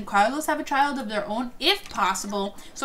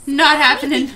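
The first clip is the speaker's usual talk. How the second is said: "Not happening" pushed out much louder, hitting "Not"; loud refusal; 9 dB louder than usual. Real, not acted. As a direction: shut it down loudly. Refusing the Jay and Carlos date.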